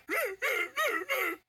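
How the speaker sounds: high mocking imitation of a laugh; imitated laugh. Mocking the radio host's laugh for listeners, a high imitated cackle. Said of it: "did y'all hear that laugh?".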